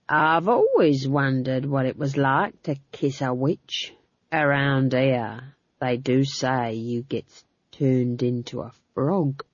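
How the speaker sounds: a coarse guard teasing, taking his time; leering guard. Acted. Guard leers at Magrat, low and slow.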